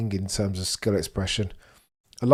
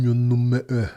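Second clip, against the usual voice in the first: Portuguese phrase attempt; 4 semitones higher, 6 dB louder, slower than usual. Shown as a demonstration. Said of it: "Portuguese, you a big fan?".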